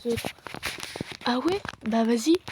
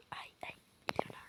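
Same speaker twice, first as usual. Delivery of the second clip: breathless fast whisper; whispering that he's here; alarmed whisper; 18 dB quieter, faster than usual. Pretend. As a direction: hiss it out in a panic.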